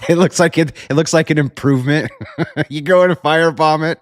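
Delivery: giggling high through the words; chuckling high through the words; laughing. Real, not acted. Laughing over firebomb joke.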